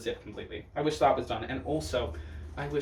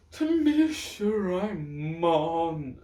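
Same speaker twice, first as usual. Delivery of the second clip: slow, loud drawn-out name; announcer voice; 4 semitones higher, 6 dB louder, much slower than usual. Real, not acted. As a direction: announce her like a host. Announcing next queen.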